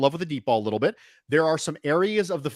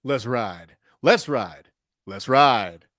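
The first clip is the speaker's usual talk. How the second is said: lightly imitated Broncos rallying cry, wrong syllables stressed; Russell Wilson; 4 semitones lower, 4 dB louder, slower than usual. A hint of the quarterback hyping Broncos country, mock chant. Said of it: "the full Russell Wilson experience".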